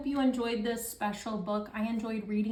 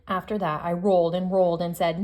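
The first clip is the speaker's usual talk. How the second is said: lively picture-book read-aloud, louder than her chat; reading to children; 6 dB louder than usual. Acted. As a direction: read it out to the class like story time. Teacher reads picture book to class.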